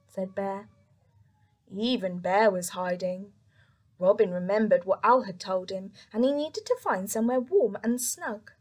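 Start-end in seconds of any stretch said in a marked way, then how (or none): none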